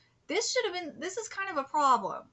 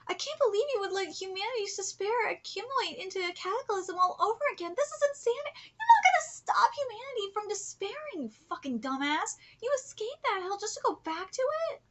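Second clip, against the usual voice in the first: higher-pitched exasperated tirade; incredulous scolding; 5 semitones higher than usual. Acted. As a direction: scold the villain in disbelief. Scolding the manga villain aloud.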